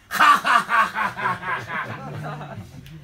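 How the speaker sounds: raspy throaty ja-ja laugh from the back of the throat; Spanish jota laugh. Acted. Spanish woman laughing with J sounds instead of H. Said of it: "les Espagnols rient avec des J".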